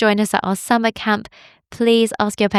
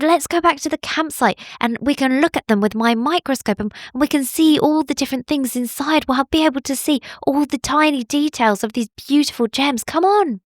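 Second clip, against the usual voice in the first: high, quick and eager little bunny; Billy bunny voice; 6 semitones higher than usual. Acted. Bunny urging friends back to camp, high and eager. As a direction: hurry them along happily.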